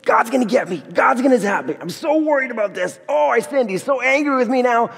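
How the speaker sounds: mock panic at an angry God, pitched high; mock fretful worry. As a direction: play a worried sinner, hammed-up. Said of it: "Does that mean we live in terror every day?".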